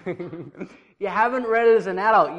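laughing through the line; teasing a friend by name, laughing. Real, not acted. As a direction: chuckle while teasing a friend.

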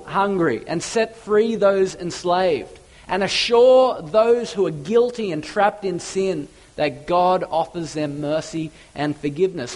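ordinary delivery

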